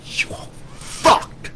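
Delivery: very slow, voiceless, strained threat; drawn-out warning. Real, not acted. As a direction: stretch it out with almost no voice. Warning anyone who joins the enemy.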